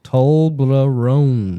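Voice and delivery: Toblerone drawn out slowly, syllable by syllable; sounding it out. Shown as a demonstration. Host sounding out an unfamiliar chocolate name for players, slowly. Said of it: "Sound it out".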